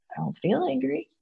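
soft, hesitant protest, lifting at the end; meek denial. Retold or imitated. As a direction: re-enact it quietly, rising as if unsure. Re-enacting her denial of anger.

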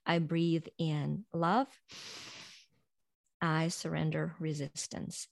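slow, soft breathing mantra; calm affirmation. Shown as a demonstration. A coach demonstrates the breathing affirmations for listeners, slow and soft. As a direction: let each word settle on the breath. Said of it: "and start saying".

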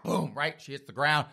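usual speaking voice

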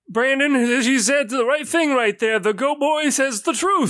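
high lightly voiced old man; a hint of a pleased elder; high pleased old shopkeeper. Old man praising Go Boy.

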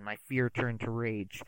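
ordinary delivery

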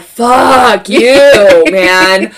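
loud, drawn-out retold cursing; exasperated outburst. Acted outburst at mom after contraction.

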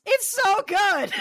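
high, slow delighted laugh-talk. Real, not acted. Friend gushing over the bird skull dress, laughing. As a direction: squeal it out through laughter.

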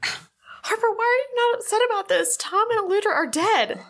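upset. Acted. Urgent pleading with oblivious brother.

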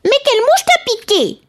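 very high, squeaky wife. Acted. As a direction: squeak the question. Wife questions husband.

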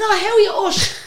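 very high, laughing mock gush; gushing greeting. Singer greets [name] once told who he is, gushing. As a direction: greet him in an exaggerated squeal.

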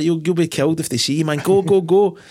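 high, hammed-up movie urging; mock urgent warning. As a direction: push your mate to flee, in imitation. Voicing character urging mate to flee.

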